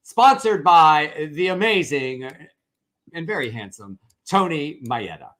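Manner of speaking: loud, bright announcer read. Pretend. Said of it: "let me do my announcer voice".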